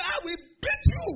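very high, loud mock yell; shouted threat. Big student threatening to beat him, high shouted threat. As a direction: shriek it as the enraged bully.

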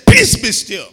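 loud, slow, forceful command; booming command to the storm; not calm, commanding. Acted. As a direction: rebuke the storm with authority. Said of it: "So he said".

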